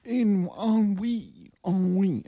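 in ennui and ennui stretched very slow and high, a put-on French voice; mock French drawl. Podcaster mocking ennui, drawn-out high mock French. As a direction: sing out ennui, ennui slowly and way up high like a snooty Frenchman.

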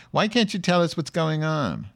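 high-pitched mock complaint; whining listener imitation. Host mocking thick-skulled listeners who miss innuendo, whiny imitation. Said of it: "they'll say".

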